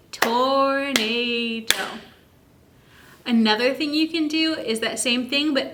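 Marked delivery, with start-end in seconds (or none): 0.1-2.0 "tornado" split slowly into tor-na-do; clapped syllables. Shown as a demonstration. Parent claps out "tornado" with child, slow syllables. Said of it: "Clap it out with me".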